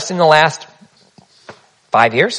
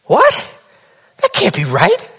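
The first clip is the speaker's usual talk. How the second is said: 1 shocked disbelief; 6 semitones higher, slower than usual. Retold or imitated. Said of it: "everyone in the class goes".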